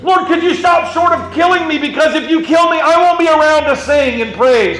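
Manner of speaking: high-pitched shouted plea; David. Acted. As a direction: bargain desperately with God at full voice. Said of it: "This is David going".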